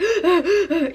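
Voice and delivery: sharp loud gasp high in the throat; one sudden, loud, sobbing intake of breath; hysterical crying gasp. Shown as a demonstration. Acting coach showing a hysterical sob, loud gasp. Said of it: "hysterically crying".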